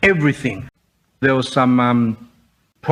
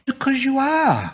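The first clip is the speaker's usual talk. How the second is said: high, flippant brush-off; tossed off without a care; mocking a careless girl's answer; dismissive; 10 semitones higher than usual. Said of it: "And that was her attitude".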